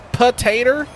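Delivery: potater said "puh-TAY-ter", the final o turned into er; Southern drawl. Shown as a demonstration. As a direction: potater with an r ending, Southern style. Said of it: "two different ways I can pronounce that".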